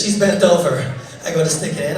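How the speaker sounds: ordinary talk, nothing marked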